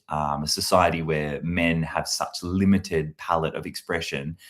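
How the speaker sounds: ordinary talk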